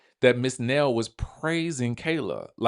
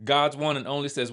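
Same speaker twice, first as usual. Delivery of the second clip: ordinary talk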